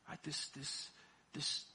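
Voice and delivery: ordinary talk, nothing marked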